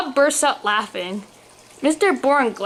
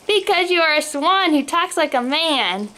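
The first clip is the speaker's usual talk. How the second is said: plain reading voice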